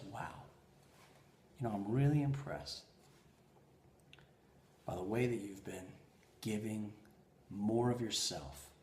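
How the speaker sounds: low, soft, heartfelt words with pauses; hushed, tender admiration; sincere praise. Retold or imitated. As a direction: tell them quietly how impressed you are. Wife's praise retold sincerely.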